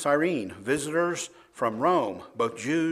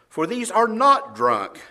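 loud, high, slow rebuttal; slow, emphatic raised voice; 6 semitones higher, 8 dB louder, slower than usual. Acted. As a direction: deny it forcefully to the crowd. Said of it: "he raised his voice and said to them".

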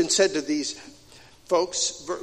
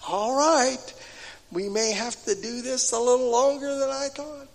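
a lightly voiced patient God, a bit higher; a hint of God, patient and resigned; God's voice; 5 semitones higher than usual. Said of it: "And God says".

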